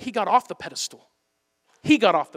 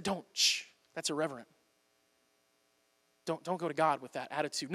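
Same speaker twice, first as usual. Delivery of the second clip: soft, shushing mock rebuke; quiet scolding, like church folk shushing; hushing; 3 semitones lower, 11 dB quieter than usual. Church folks hushing a doubting questioner, soft scolding. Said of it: "Everyone quieted you and said".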